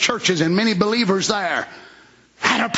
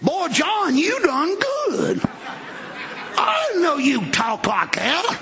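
high, drawn-out voice of an impressed wife; slow, high-pitched folksy wife; admiring wife; 8 semitones higher, slower than usual. Acted. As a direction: play the amazed wife praising her husband. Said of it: "His wife's there and said".

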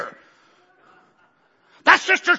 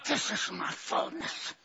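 fading, hushed repeat; 11 dB quieter, slower than usual. Acted. Repeated quote, dropped to near whisper.

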